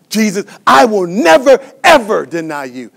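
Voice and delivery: acting out Peter's emphatic promise; emphatic vow. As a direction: swear it forcefully, hammering NEVER. Peter's vow, insistent second take.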